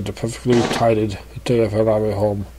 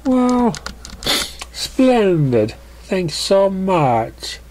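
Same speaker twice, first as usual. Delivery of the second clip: voiced pony; 8 semitones higher, much slower than usual. Character responding.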